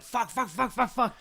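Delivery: rapid high-pitched fuck fuck fuck; panicked frantic swearing, way up high; high frantic cursing. Retold or imitated. Frustrated exclamation retelling scene.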